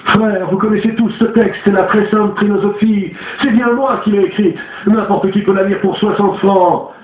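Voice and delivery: high, heated denunciation; indignant accuser. A narrator's reading. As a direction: expose the fraud to the brothers. Tavillier denounces the imposture.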